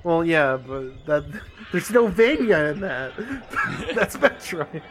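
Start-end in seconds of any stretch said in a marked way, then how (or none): 1.5-4.8 talking through laughter; giggling while speaking; laughing. Real, not acted. Laughing through his point.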